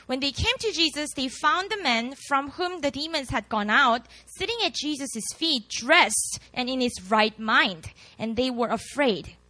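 ordinary delivery

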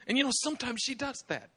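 ordinary delivery

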